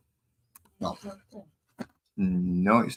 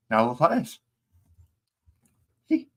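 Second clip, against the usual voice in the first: mouth full; 5 semitones higher, 3 dB louder, faster than usual. Real, not acted. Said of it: "Don't talk with your mouth full".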